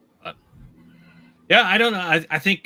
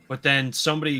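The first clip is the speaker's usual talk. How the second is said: usual speaking voice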